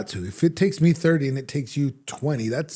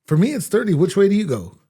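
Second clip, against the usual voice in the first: loud protest; 6 semitones higher, 7 dB louder than usual. Real, not acted. Heated argument over drive time.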